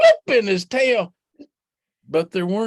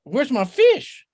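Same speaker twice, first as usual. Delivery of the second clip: high, gleeful rabbit voice; triumphant Rabbit; 8 semitones higher, 3 dB louder than usual. Acted. Rabbit thinks he caught a fish, high and gleeful. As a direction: crow it out, high and glad.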